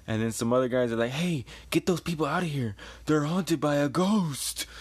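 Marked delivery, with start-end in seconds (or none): 1.1-4.7 hammed-up panicked warning; mock alarm. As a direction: call out a spooky warning, stretching the end. Guys in story call out alarm.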